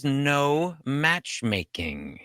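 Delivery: slow, deliberate no; stern slow warning to his wife; firm warning. Acted. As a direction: lay down the law word by word. Husband firmly forbidding matchmaking.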